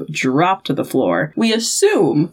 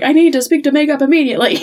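quick, higher-pitched mock urgency; urgent demand; 6 semitones higher, 4 dB louder, much faster than usual. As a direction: act out a hurried call for help. Director calls urgently for makeup.

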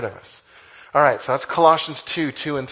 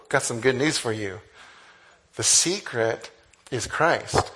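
usual speaking voice

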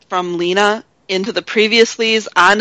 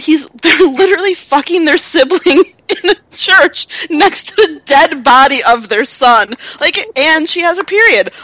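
laughing; 8 semitones higher, 4 dB louder than usual. Real, not acted. Appalled laughing about the scene.

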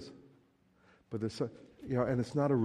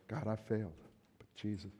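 quiet confession; 8 dB quieter than usual. Pretend.

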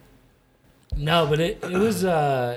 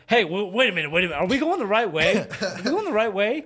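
higher-pitched, hurried imitation of confusion; mock worried doubt; 5 semitones higher, faster than usual. Imitating hiking buddy at a slight uphill, mock worried. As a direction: question it urgently, playing the doubter.

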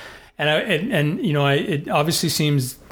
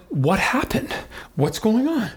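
pitched up high, bewildered; retold disbelief; 9 semitones higher than usual. Husband retelling his marathon collapse, bewildered and high. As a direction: recall the shock of your legs quitting. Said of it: "And I was like".

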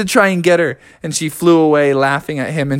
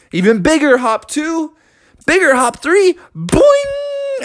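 climbing higher with each hop to a squeal; building excitement; 11 semitones higher than usual. A narrator's reading. Narrator counting Froggy's hops before take-off, rising excited voice. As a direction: ratchet the pitch up to a springy boing.

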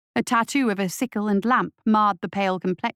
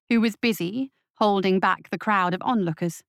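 plain reading voice

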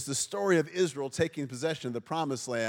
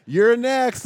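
high, loud deadpan taunt; cheeky payback; 8 semitones higher, 13 dB louder than usual. Acted. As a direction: fire it back with mock innocence. Says it to old people at funerals.

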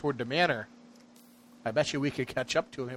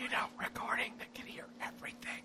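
hushed conspiratorial whisper; worried whisper; 11 semitones higher, 11 dB quieter than usual. Real, not acted. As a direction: whisper so nobody hears. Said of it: "Why are we whispering?".

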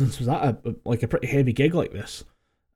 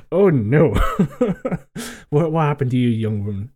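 mock concern; 2 semitones higher, 3 dB louder than usual.

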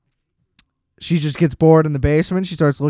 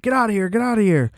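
retold scream; 6 semitones higher, faster than usual. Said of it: "Some guy's screaming at her".

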